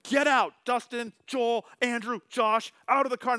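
loud, high furious yell; angry shout. Retold or imitated. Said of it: "he was like".

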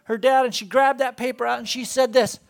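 ordinary delivery

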